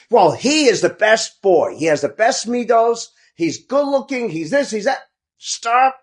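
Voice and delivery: high-pitched mock gushing, ending in protest; exasperated mimicry. Kids mimicking praise of the best boy, exasperated. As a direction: imitate fed-up kids parroting the praise.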